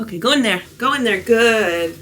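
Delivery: usual speaking voice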